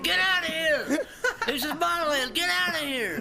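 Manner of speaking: high, loud hammed-up yell at the monster; hunter shouting. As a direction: shoo the ogre away, yelling. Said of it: "it's a hunter saying".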